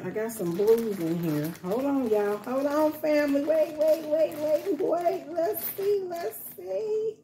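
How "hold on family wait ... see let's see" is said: high, hurried wait wait; eager searching. Real, not acted. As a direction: rummage and rise in pitch. Searching bag, voice climbs high.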